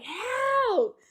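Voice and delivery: high, loud cry for help with a laugh; retold scream for help. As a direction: call out desperately for help. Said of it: "I screamed".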